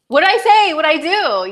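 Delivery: high-pitched, defensive outburst; acted baffled indignation; mock indignant protest. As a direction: protest your innocence, voice jumping up. Retelling her protest to husband.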